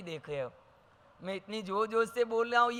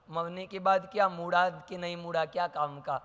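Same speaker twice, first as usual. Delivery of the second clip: ordinary talk, nothing marked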